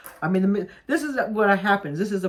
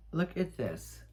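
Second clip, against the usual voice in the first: ordinary talk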